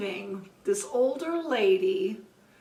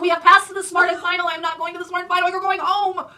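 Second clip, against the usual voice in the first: high, loud, fast exasperated scolding; fed-up mock scold, louder and higher; firm refusal; 7 semitones higher, 9 dB louder than usual. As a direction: put your foot down with the old lady. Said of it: "I said".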